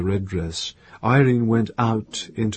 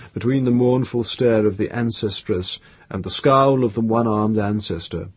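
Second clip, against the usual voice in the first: ordinary delivery, nothing marked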